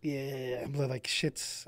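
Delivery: soft, breathy near-whisper on shit. Shown as a demonstration. Telling of going quiet on stream, near whisper. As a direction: let it slip out under the breath. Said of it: "if I get really quiet".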